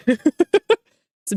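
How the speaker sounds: words slowed by laughter; laughing. Real, not acted. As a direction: talk through the giggles. Said while laughing.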